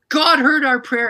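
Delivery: loud, high, slow cry of joy; joyful outcry. Retold or imitated. Wife crying out that prayer was answered, loud and high. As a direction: burst out praising. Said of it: "she said".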